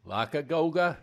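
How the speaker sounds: a loud, high, hammed-up Boston accent; broad Boston accent, played for laughs. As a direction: redo the joke like a Boston local. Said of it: "that joke ought to be with a Boston accent".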